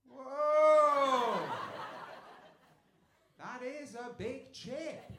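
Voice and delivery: loud whoa, then slow impressed; hammed-up amazement at a chip; mock awe. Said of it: "the other person will always go".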